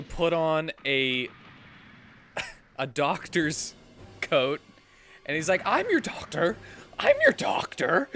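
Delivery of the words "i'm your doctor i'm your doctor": high-pitched, insistent announcement; imitated manic insistence. Acting out fake doctor insisting.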